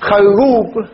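خروب with a fatha on the kha and the ra doubled. Shown as a demonstration. Teacher showing students the variant with the doubled r, said deliberately. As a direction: say خروب as xarrub, leaning on the doubled r. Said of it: "أو يشد راؤه".

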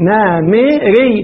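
very drawn-out نمري, each short vowel sounded separately; syllable by syllable. Shown as a demonstration. Teacher shows the two kasras, نمري split very slowly as "na-mi-ri". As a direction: break نمري into "na... mi... ri", very slow. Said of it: "الميم مكسورة وراء مكسورة".

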